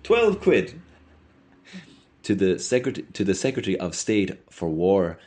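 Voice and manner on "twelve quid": very high-pitched, louder shout; shouted answer, voice pitched right up. A narrator's reading. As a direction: call the figure out high and loud. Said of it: "Mulligan shouted".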